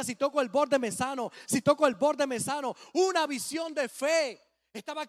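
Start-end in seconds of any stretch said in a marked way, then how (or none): 0.0-2.7 desperate repeating. Retold or imitated. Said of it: "se arrastraba y decía".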